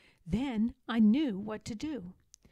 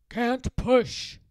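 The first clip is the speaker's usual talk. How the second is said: loud gruff troll refusal; troll cry; 8 dB louder than usual. Acted. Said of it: "they cried".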